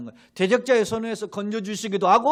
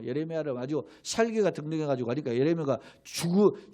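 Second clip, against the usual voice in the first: ordinary talk, nothing marked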